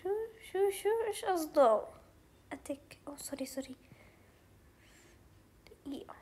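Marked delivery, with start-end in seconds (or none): 2.5-3.8 breathy whisper, no voice; hushed sudden whisper. Real, not acted. Drops to a whisper mid-game. 5.9-6.2 breathy whisper, no voice; hushed sudden whisper. Real, not acted. Whispers while concentrating.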